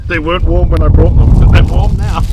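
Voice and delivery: loud, high surprised exclaim. Real, not acted. Surprised at warm food.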